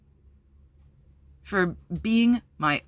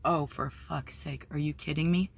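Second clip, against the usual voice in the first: seeing the ex staring across the lot, quiet disbelief; exasperated; 2 semitones lower, 6 dB quieter than usual. Retold or imitated. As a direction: roll your eyes at it under your breath. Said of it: "and I was like".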